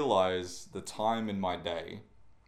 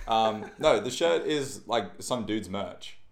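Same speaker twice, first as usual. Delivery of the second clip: ordinary talk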